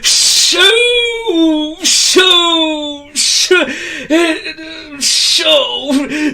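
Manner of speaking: high loud hissing shoo; letting off steam. Acted. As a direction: blast steam out jealously. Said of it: "he let off steam".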